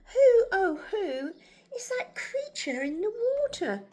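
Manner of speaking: high-pitched, wide-eyed storybook question; wondering little dinosaur. A narrator's reading. Narrator voices Drip asking about his reflection, wondering.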